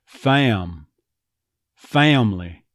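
"Fam" as "FA-uhm"; two-syllable "Family" with a schwa after the A; stressed off-glide. Shown as a demonstration. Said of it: "could get that off glide".

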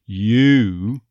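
"you" said as a schwa gliding to "oo"; on-glide you. Shown as a demonstration. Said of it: "we just had that on glide".

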